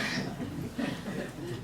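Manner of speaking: breathless unvoiced nervous laugh; embarrassed laugh. Real, not acted. Speaker laughing at her own blank moment, breathy nervous laugh.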